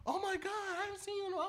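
high, fast excited coworker voice; imitating the manager. Ex-manager greeting him at Walmart, high fast mock gush. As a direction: squeal it out like a surprised coworker. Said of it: "and she was like".

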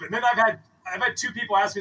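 ordinary talk

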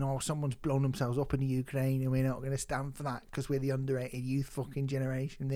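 lightly mocking, low monotone rant; soft, low send-up of an earnest young rocker. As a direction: take the piss quietly, droning along.

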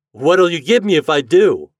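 plain reading voice

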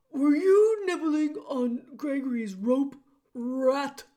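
loud, gruff jailer voice; booming male voice. A narrator's reading. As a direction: boom down at the little rat. Jailer catches rat nibbling rope.

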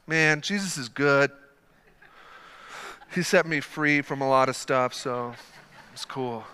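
low, subdued and offhand; half-hearted praise. Acted. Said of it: "No, this is like a".

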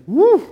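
short high, loud "woo"; mock startled whoop. Onlooker gawking at the screaming widow, mock startled whoop. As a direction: let out a sudden high whoop. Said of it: "stop and stare and say".

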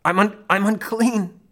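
high, strained anguished cry; choked out. Retold or imitated. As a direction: force the word out through pain. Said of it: "he choked out the dreaded words".

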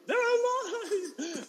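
very high, drawn-out squeal; slow, squeaky mock-triumphant cry; imitated ecstatic boy. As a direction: stretch it out like a child announcing a miracle. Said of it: "and he goes".

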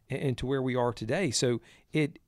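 ordinary talk

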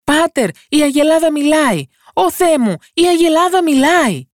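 high, loud panicked cry to her father; frightened shout. A narrator's reading. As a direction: yell in alarm that the cow speaks. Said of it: "φωνάζοντας".

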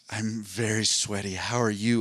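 whispered, slow seductive purr; sultry voice. Pretend. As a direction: breathe it out like a femme fatale. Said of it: "let's pretend she did".

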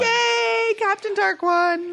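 excited cheer. Pretend. Cheering the dark Hook.

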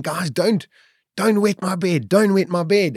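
high-pitched acted plea, urgent and insistent; pleading protest. Dreamer telling his mates not to wet his bed, high pleading protest.

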